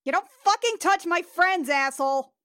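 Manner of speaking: loud, high in-character shout; protective angry yell. Acted. Player warning off the snake that hurt friends, angry shout. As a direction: yell it out in defence of your friends.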